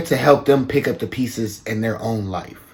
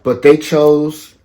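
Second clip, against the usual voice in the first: louder, higher, pressing the point home; raised voice, slowing on "they chose"; emphatic raised voice; 5 semitones higher, 8 dB louder than usual. Real, not acted. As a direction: lean in hard on the viewer.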